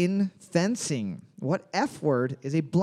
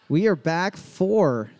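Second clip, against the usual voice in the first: trivia host announcer; 3 semitones higher, slower than usual. Acted. Host reopens trivia round.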